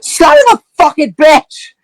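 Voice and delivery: high-pitched screamed curse; furious scream. Acted. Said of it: "She just, like, fucking screams".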